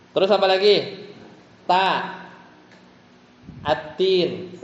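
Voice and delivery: usual speaking voice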